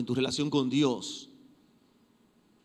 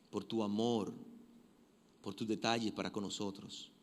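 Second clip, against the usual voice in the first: hushed heartfelt prayer; closing prayer; 2 semitones lower than usual. Acted. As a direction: pray humbly for the church.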